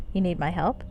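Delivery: ordinary talk, nothing marked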